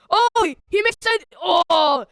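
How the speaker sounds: loud, high commentator's cry; excited outcry. Real, not acted. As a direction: cry out as the chance falls apart. Commentator reacts to a chance.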